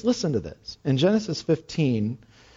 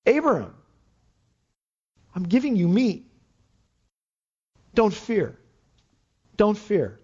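higher, gentle voice of the Lord; tender reassurance; 4 semitones higher than usual. Acted. The Lord comforting weary Abram, tenderly. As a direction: soothe a frightened man. Voicing the Lord's tender words.

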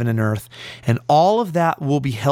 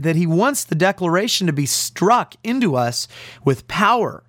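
normal talking voice

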